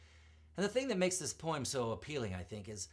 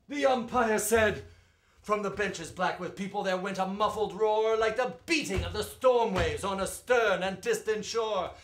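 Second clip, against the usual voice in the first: rising and falling ballad read, full of drama; spirited verse reading; dramatic poem recitation; 7 semitones higher, 9 dB louder than usual. Acted. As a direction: recite it like a storyteller at the ballpark.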